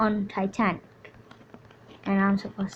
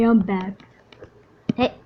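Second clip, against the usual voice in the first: ordinary delivery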